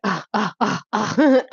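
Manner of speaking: high cries of being hit, breaking into a laugh; mock pained cries. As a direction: yelp like you're taking punches, then laugh. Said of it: "Then your shit was like".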